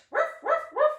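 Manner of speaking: very high playful woofs; dog barking. Acted. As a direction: yap like a cheeky little dog. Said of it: "Maybe you're barking".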